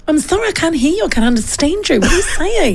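high, puzzled mock teacher. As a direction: play the baffled teacher who can't understand. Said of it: "she's sitting at the front going".

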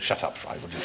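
soft hushed snap; whisper-quiet telling-off; hushed grandfather. Retold or imitated. Grandfather silencing young Fry, in a soft hushed voice. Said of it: "He goes".

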